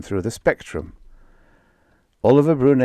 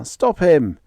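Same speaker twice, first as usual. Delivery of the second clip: louder, higher panicked shriek; 8 semitones higher, 5 dB louder than usual. Acted. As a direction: cry the alarm. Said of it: "shrieked Lazar".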